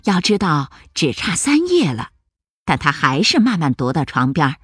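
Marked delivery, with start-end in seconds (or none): none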